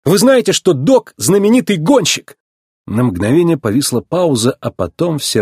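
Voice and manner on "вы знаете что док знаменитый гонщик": high-pitched, eager question; excited, high telling of news; excited announcement. A narrator's reading. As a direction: burst out with big news. Breathless news announced to the townsfolk.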